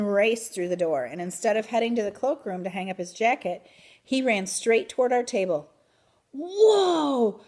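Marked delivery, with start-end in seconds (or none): 6.3-7.4 high, loud amazed shout. A narrator's reading. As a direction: shout out in wonder. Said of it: "he shouted".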